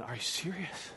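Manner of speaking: a hushed, shocked gasp of disbelief; scandalized Dutch woman gasping at the missionary. Retold or imitated. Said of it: "the Dutch woman just started going".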